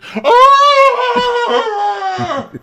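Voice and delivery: long, very high, loud wail; exaggerated shriek of agony falling away; mock scream. [name] heard over the hospital phone, long high mock scream.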